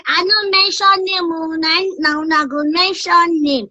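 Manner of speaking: put-on high playful kid voice, teasing; a naughty child dodging the question; baby voice. Child character answering curious callers. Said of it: "just use that baby voice".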